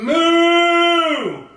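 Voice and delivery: loud mooing cow. Acted. Dog George opening his mouth, a loud moo.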